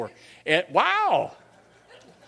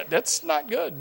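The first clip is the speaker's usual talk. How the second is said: ordinary talk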